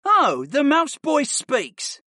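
high, slow mocking taunt; sneer. A narrator's reading. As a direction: draw it out in mock amazement. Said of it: "Darius sneered".

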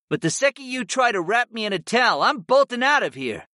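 high, fast character voice; cat familiar warning. Acted. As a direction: rattle off the warning as a reluctant cat.

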